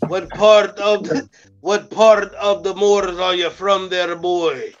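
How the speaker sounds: slow, drawn-out Scottish accent. Shown as a demonstration. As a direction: stretch the words out in a put-on Scottish brogue. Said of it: "let me hear your Scottish accent".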